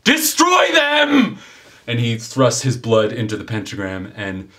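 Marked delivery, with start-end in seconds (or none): 0.0-1.4 high-pitched, full-throated incantation, slowing on the last words; villainous summoning shout. Acted. Summoner calling on a demon to destroy enemies, villainous shout. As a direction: bellow it as a command to a demon. Game master acts out a summoning.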